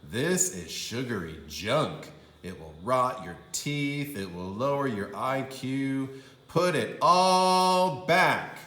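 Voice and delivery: father's lecture ending loud and slow; scold, then order it back; a hint of a stern dad. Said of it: "said her father".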